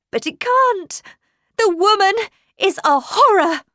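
very high, loud exclamation; horrified outburst. A narrator's reading. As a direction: burst out in horror. Governess recoils in horror.

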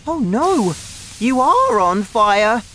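high, loud dismayed cry; alarmed boy. A narrator's reading. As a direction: exclaim in alarm, pitched high. Boy alarmed at smoking grandma.

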